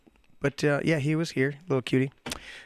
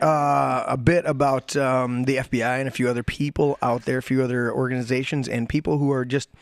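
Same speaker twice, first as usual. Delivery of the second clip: ordinary talk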